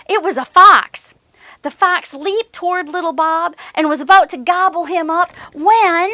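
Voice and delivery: storyteller building to a scare; rising suspense. A narrator's reading. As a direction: tension climbing as the fox leaps. Suspense builds before roar.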